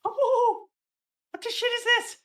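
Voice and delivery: high, loud outburst; shocked character voice. Acted. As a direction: yelp like a startled pirate. Reading a manga character.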